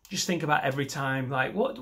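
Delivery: usual speaking voice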